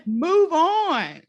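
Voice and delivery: high, emphatic mock-exasperated order; exasperated God. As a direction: send them off like a fed-up God.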